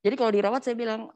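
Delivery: usual speaking voice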